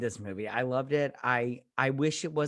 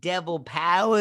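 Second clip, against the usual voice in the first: ordinary talk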